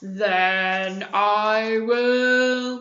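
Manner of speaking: very slow, drawn-out wolf; wolf threat. Acted. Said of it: "he shouted".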